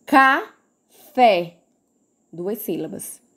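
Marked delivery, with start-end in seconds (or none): 0.1-1.6 "Café" split as ca, fé, with a gap; syllable by syllable. Shown as a demonstration. Teacher showing two syllables, "Café" said in separated syllables. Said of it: "abriu e fechou a boca duas vezes".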